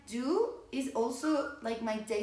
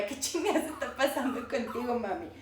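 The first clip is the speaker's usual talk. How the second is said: laughing while quoting. Retold or imitated. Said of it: "él empezó así como".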